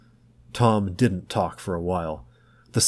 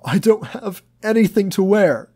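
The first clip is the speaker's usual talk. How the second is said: much higher, louder tearful plea; choked cry; 12 semitones higher, 6 dB louder than usual. A narrator's reading. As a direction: choke it out in tears. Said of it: "he choked".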